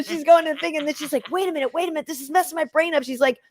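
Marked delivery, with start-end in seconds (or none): 1.3-3.0 high-pitched mock fluster; flustered imitation. Imitating the New York girl, high and flustered. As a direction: imitate someone confused, voice pitched up.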